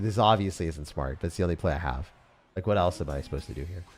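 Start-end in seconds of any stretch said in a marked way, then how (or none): none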